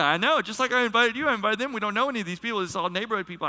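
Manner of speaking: high, insistent mock explanation; flustered explaining. Host to baffled guest about inviting strangers, flustered explaining. Acting his flustered answer.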